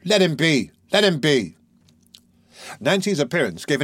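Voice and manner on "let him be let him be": hasty Nancy. Acted. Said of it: "coming hastily forward".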